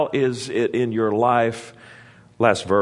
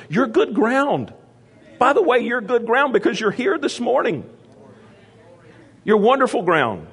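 excited preaching; 12 semitones higher than usual. Real, not acted. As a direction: lift the church up with joy. Preacher lifting congregation.

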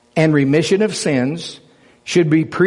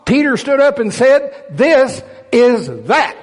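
high, loud fervent preaching; preaching at full force; 7 semitones higher, 3 dB louder than usual. Acted. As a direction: preach it with authority and fire. Preaching the command to wait.

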